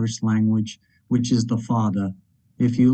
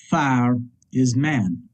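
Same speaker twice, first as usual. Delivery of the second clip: ordinary delivery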